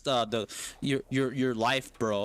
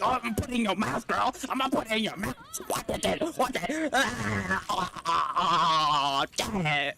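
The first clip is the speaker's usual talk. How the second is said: mock killer voice; 5 semitones higher than usual. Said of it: "No, he's like".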